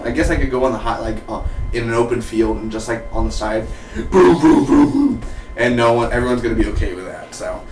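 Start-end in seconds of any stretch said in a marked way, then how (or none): 4.1-5.2 loud booms jumping higher; imitated gunshots. Reviewer acting out shooting in a field, loud mock gunfire.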